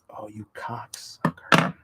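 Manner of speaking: slow hushed curse under the breath; whispered curse. Real, not acted. Crafter swears at the fraying trim, whispering slowly.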